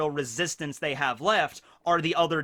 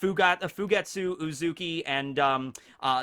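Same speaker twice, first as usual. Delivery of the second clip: ordinary talk, nothing marked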